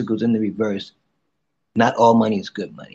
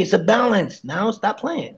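ordinary delivery

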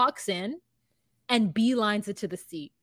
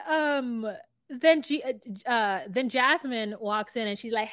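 normal talking voice

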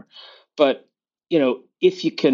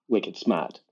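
Boston accent; 5 semitones lower, 6 dB quieter than usual. Real, not acted. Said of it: "That's a Boston accent".

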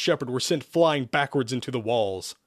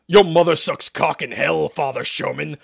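loud, raised venomous insult; spat out; 3 semitones higher, 5 dB louder than usual. A narrator's reading. Demon insulting the exorcist, spat out. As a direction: spit it at the priest. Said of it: "Isaac spat".

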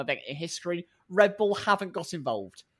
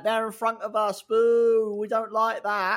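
put-on drawn-out childish booing, raised pitch; mock whining protest; 4 semitones higher, slower than usual. Podcaster mimicking rival team grumbling, drawn-out mock whine. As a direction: complain like a sulky heckler.

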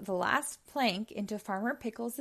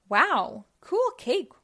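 loud, much higher amazed cry; amazed wow; 7 semitones higher, 7 dB louder, slower than usual. A narrator's reading. Rolly admiring the concrete cake, amazed. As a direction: gasp at the cake in wonder. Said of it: "Rolly said".

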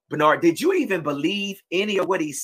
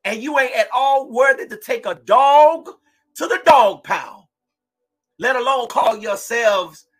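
ordinary talk, nothing marked

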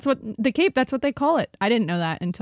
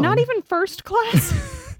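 mock surprised; 12 semitones higher, slower than usual. Real, not acted. Mock indignation.

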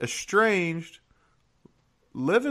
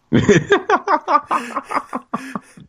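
loud laugh, pitched up; loud high laugh; 12 semitones higher, 7 dB louder than usual. Real, not acted. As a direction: burst out laughing at own joke. Laughs at his own joke.

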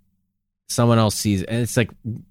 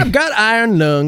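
put-on old man voice; 7 semitones higher, 3 dB louder than usual. Old heckler voice.

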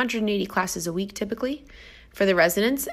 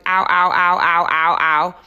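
louder yelps of pain, ow after ow; pained ow cries; 6 dB louder than usual. Retold or imitated. Trainer stung by a bee in the park, loud pained ow yelps. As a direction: yelp ow ow ow as if stung again. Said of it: "kind of dancing around going".

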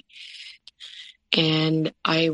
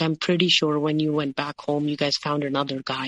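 normal talking voice

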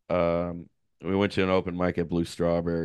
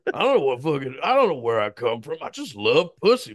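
imitating a comic; 6 semitones higher than usual. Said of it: "a guy being like".

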